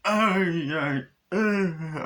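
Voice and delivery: high, loud yelps; mock pained cries. Doll crying out as legs are smashed, high yelps. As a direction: yelp like a doll in pain.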